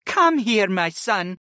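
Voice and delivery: motherly invitation; welcoming mother. Acted. Retreya welcomes son-in-law.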